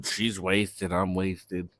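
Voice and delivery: usual speaking voice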